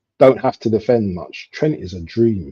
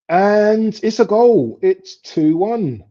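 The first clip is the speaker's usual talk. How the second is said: high, loud, stretched-out announcement; raised, drawn-out commentator's cry; excited goal call; 11 semitones higher, 5 dB louder, much slower than usual. Real, not acted. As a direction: draw out the goal call slowly. Calling a goal live.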